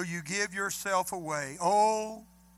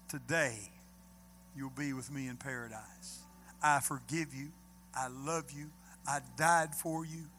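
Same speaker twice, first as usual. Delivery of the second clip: tender; 5 semitones lower, 4 dB quieter than usual. Acted. Jesus's words to the listener.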